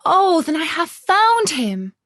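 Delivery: put-on girl thrilled her lost friend is found; overjoyed. A narrator's reading. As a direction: burst with joy at finding him. Gerda overjoyed at finding Kay.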